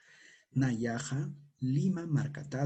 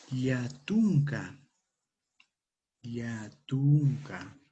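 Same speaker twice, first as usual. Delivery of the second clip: slow model; 5 dB louder, much slower than usual. Shown as a demonstration. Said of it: "Repitan conmigo".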